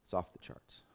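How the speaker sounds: "It's off the charts." repeated as a soft whisper. Real, not acted. Preacher repeating the cost to his group, whispered. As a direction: breathe "It's off the charts." in awe, unvoiced.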